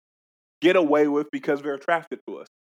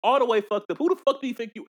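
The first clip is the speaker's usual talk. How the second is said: high-pitched, hammed-up indignation; mock outraged telling-off; 6 semitones higher than usual. Co-host imagining his reply to her texter, mock outraged. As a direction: jump up in pitch as if telling someone off.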